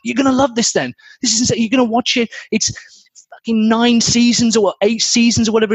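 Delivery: high, excited fan gushing; eager hard sell. Retold or imitated. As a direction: pile on the hype like a superfan. Fan hard-selling the series.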